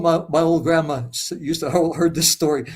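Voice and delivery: ordinary talk, nothing marked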